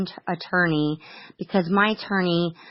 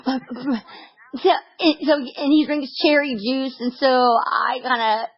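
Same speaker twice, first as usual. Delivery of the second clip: higher, slow words squeezed through laughter; laughing while talking; 8 semitones higher, much slower than usual. Real, not acted. Mother admitting she's a control freak, laughing through words. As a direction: giggle your way through the line.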